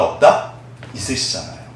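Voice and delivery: ordinary talk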